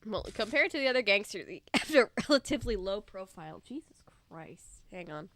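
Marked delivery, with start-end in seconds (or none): 3.7-5.3 quiet, throat catching after cough; muttered through an allergy cough. Real, not acted. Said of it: "It's just allergies".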